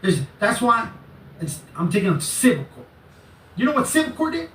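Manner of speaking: very high, squeaky agitated imitation; mock his challenge, pitch climbing way up; mimicking his ranting. Imitating the man threatening civil court, high-pitched mocking rant. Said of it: "And he went like that, right?".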